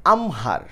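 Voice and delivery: আমহার as "am-har", h sounded; correct pronunciation. Shown as a demonstration. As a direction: say আমহার with an h following the m. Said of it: "তাহলে এখানে কি?".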